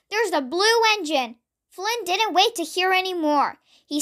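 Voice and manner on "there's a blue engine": ordinary delivery, nothing marked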